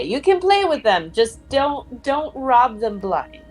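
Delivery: normal talking voice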